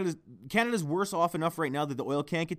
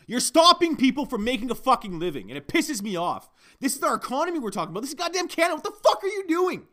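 rising angry tirade, pitched higher; building real outrage, getting higher and louder; angry rant; 8 semitones higher, 4 dB louder than usual. Real, not acted. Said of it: "it gets me fucking angry".

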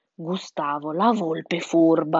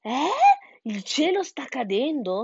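higher, incredulous duck question; squawking duck's question; 6 semitones higher than usual. A narrator's reading. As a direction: ask it as a puzzled duck. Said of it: "starnazzò la anatra". (papera che starnazza incredula)

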